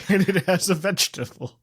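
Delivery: helpless laugh breaking into the words. Real, not acted. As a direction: talk through a fit of laughter. Cracking up at the vegetable line.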